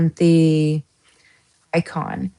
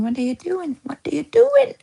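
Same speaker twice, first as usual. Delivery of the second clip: a much higher, squeaky pet voice rising at the end; voicing her pets; 9 semitones higher than usual. Her animals nosing in on her lap, squeaky pet voice. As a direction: play the curious pets butting in. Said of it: "my animals ... going".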